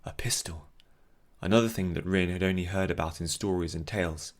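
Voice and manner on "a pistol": whispering. A narrator's reading. Whispered aside in narration.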